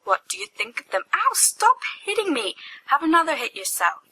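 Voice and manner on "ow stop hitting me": pained protest. Acted. Being hit by friends.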